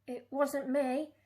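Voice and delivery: put-on a touch of a wronged young pirate; Pete protesting. A narrator's reading. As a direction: insist you're innocent. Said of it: "cried Pete".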